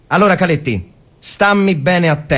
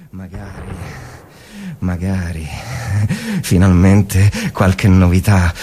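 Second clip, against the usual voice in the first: inner monologue; 12 semitones lower than usual. Acted. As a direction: think aloud in the dark trench.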